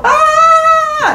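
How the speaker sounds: very high, loud scream; hammed-up shriek of horror; villagers' scream. Villagers look at the bloodied man, high loud scream. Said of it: "the villagers start screaming like".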